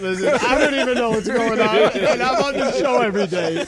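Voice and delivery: high, loud, laughing Jersey voice; Jersey accent. Pretend. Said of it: "can you do it in a Jersey accent".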